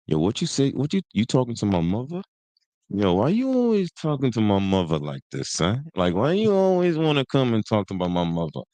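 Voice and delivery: mimicked singsong New York street voice; Kanan. Imitating a TV character defending his mother, singsong New York voice. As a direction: swoop the pitch up and glide down, in mock confrontation. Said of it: "this is how he talks, bro".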